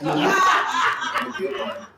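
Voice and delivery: loud, high, slow words through laughing; laughing too hard to finish; real laughter. Real, not acted. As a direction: crack up while repeating the excuse. Cracks up at own excuse.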